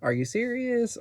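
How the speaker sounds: disbelief. Shown as a demonstration. Teacher showing what "what" can mean, incredulous. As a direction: sound like you can't believe it. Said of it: "that kinda means".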